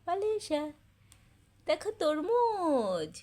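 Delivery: mother sweetly coaxing her daughter awake; sing-song coaxing. Real, not acted. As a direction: tempt her awake with a lilting, drawn-out call. Coaxing child awake with melon.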